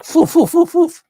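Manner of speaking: high puffed whooshes; playful stabbing sound effects; knife swish noises. Retold or imitated. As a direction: mimic the knife slashing. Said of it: "The knife is like".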